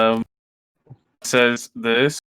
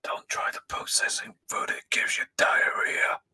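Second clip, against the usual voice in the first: breathy warning whisper; hushed secret warning; 7 dB quieter than usual. Acted. Sign read in whispered aside.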